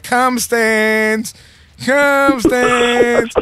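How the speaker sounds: high, drawn-out call for a pet; calling a dog. Acted. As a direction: call the dog back across a park. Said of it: "let him out in public and you're like".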